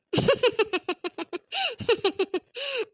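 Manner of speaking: long wicked cackle; gleeful villain laugh; evil cackle. Acted. Scheming aunt gloats, overheard.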